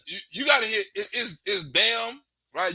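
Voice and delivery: usual speaking voice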